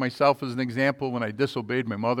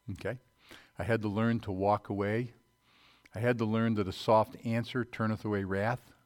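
ordinary delivery

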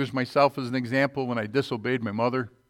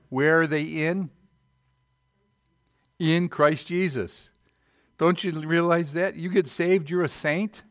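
higher, louder, emphatic preaching; fervent preaching; 6 semitones higher, 5 dB louder than usual. Acted. Preacher pressing the congregation on being saints, fervent and loud. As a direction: press the point home to the congregation.

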